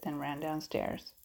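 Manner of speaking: ordinary delivery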